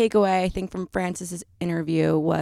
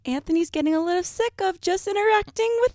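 much higher, whiny fake-sad voice; mock hurt; 13 semitones higher than usual. Teasing co-host about being sick of her, mock whine. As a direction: pout it in a high pretend whimper.

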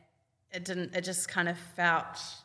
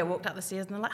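normal talking voice

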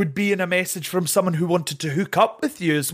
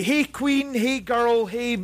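higher, breezy drag greeting; sassy greeting; 4 semitones higher, slower than usual. Retold or imitated. Setting a boundary with a breezy drag hello. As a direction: toss it off like a drag queen. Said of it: "I need to say".

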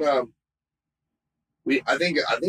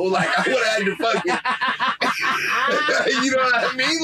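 cracking up mid-sentence at his own joke; laughing; 11 semitones higher than usual. Real, not acted. Joking to his friend about stealing the painting, laughing hard.

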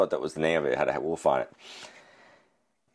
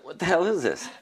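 confused surprise; 11 semitones higher than usual. Retold or imitated. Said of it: "I was like".

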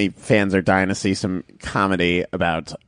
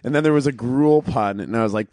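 normal talking voice

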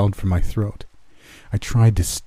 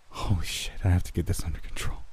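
panicked whisper; 9 dB quieter than usual. A narrator's reading. Panicked inner thought.

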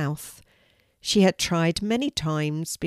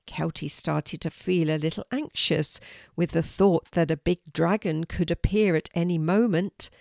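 plain reading voice

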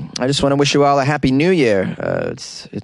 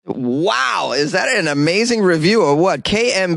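higher, excited exclamation; delighted; 6 semitones higher than usual. Real, not acted. Host thrilled by a fan review, excited. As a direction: gush over the review.

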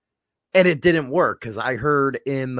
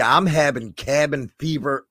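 normal talking voice

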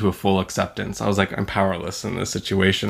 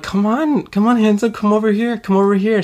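mock flirty women; 11 semitones higher than usual. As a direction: coax him over like a teasing stranger. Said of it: "they're like".

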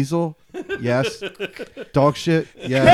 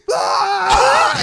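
a high, loud retold scream of "Ah!"; mock scream; 3 dB louder than usual. Dennis screams from his burning car, high, loud mock yell. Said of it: "he's driving by Gebby screaming".

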